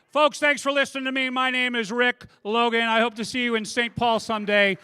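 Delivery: big, booming showman farewell; loud announcer sign-off. Real, not acted. Comic announcing his exit and next act loudly. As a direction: belt it out to close the set.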